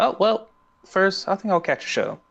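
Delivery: high, put-on niceness; sweetly manipulative imitation, pitched right up; Azula. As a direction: feign being really nice, high-voiced. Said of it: "being, like, really nice".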